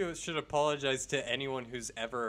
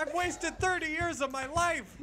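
high, louder mock despair; mock lament; 13 semitones higher, 5 dB louder than usual. Astronaut lamenting his wasted years, hammed-up wail. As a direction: wail it like a ruined man.

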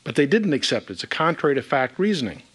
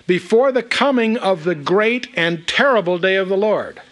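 raised, ringing reading of a solemn verse; emphatic scripture reading; 4 semitones higher, 4 dB louder than usual. Real, not acted. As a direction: proclaim the prophecy, pitched up. Reads the verse aloud emphatically.

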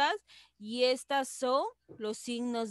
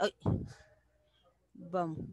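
normal talking voice

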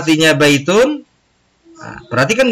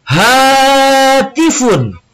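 "Hatifun" louder and higher, slow and drawn out; louder repetition; 11 semitones higher, 3 dB louder, much slower than usual. Shown as a demonstration. Teacher urges students to repeat "Hatifun" louder. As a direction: call out "Hatifun" with more voice. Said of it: "lebih keras suaranya".

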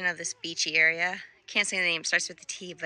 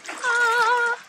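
excited scream; 14 semitones higher, 9 dB louder than usual. Real, not acted. Jumping into the cold water.

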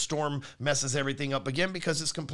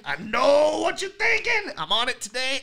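hammed-up singsong guess; mock know-it-all voice; 9 semitones higher, 7 dB louder than usual. Shown as a demonstration. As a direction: stretch it out as if reading their mind. Mocking the viewer's doubt.

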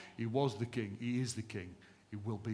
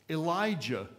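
normal talking voice

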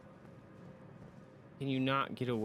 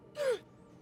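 startled high gasp; sharp frightened gasp. Real, not acted. Sudden fright.

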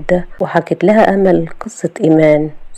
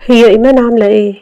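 higher, hurried worried question; quick anxious eagerness; eager, anxious; 5 semitones higher, 4 dB louder, faster than usual. A narrator's reading. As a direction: ask urgently after your wife. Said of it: "أحمد بلهفة".

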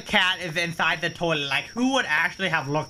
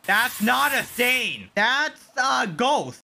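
drawn-out, higher, teasing ghost line; slow spooky reveal; 6 semitones higher, much slower than usual. Pretend. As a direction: spell it out slowly for effect.

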